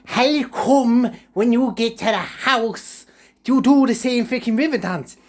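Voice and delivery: high, slow mocking granny voice; a high nagging old lady; Granny's voice. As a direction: squeak it out like a nosy old granny. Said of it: "She was like".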